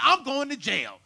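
high, loud panicked scream; terrified cry. Retold or imitated. Son caught naked by police, screaming in panic. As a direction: shriek it in terror. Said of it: "I'm like".

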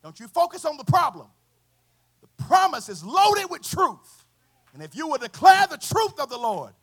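high, loud sermon exhortation; full-force preaching. Acted. Preacher challenging every frustrated person, full-force preaching. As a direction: ring it out to the whole room.

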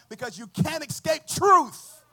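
high, loud, slow declaration; full-force preaching. Acted. Preacher declaring truth cannot be escaped, full-force preaching. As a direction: drive the point home in a drawn-out shout. Preacher peaks on his point.

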